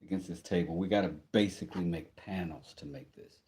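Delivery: ordinary delivery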